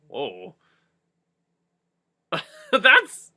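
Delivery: ordinary delivery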